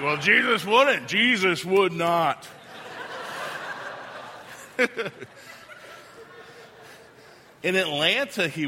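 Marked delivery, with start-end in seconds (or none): 0.0-2.5 indignant. Pretend. Congregation's indignant imagined reply. 2.7-5.2 breathy laugh. Real, not acted. Laughs at own joke.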